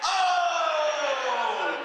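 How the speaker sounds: high, loud crowd oh; crowd ooh. Retold or imitated. The audience reacting to the roast, loud high oh. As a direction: whoop like a stunned crowd. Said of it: "Everybody went".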